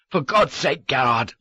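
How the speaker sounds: a desperate shout; shouted plea. A narrator's reading. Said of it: "I shouted".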